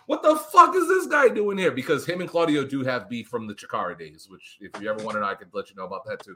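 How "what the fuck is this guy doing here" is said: high, loud mock yell of a furious wrestler; mocking a wrestler's temper tantrum at ringside; imitated tantrum. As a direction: screech it like a grown man throwing a fit. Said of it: "He threw a temper tantrum".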